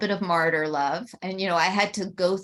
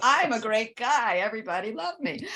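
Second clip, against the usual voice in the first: higher, put-on smug voice, fast at the end; mocking the father; 7 semitones higher than usual. Daughter parodying her father as suddenly smug, mocking. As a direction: parody him bragging about himself. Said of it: "go and go".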